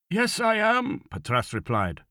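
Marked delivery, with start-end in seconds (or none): 0.1-1.1 put-on nervous old man. A narrator's reading. Nervous old man answers the officer.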